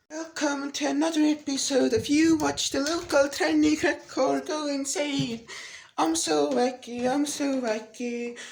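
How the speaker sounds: slow, drawn-out wacky host act; stretched-out mock host voice; mock video intro. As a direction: parody a zany episode intro, dragging out the words. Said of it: "so that's the Dodesmite video done".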